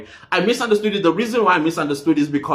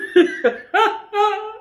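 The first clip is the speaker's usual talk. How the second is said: high-pitched laugh. Real, not acted. Host cracking up at a viewer's comment, high giggling laugh.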